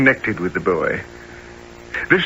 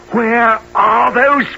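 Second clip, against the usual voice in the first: stern demand; 10 semitones higher, 4 dB louder than usual. Acted. Brownlow pressing hard.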